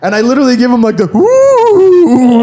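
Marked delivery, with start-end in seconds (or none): 1.1-2.4 high, loud mocking woo like a little kid; mock Razor Ramon woo; childish mock woo. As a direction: taunt him like a kid on the playground. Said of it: "like, a little kid mockery".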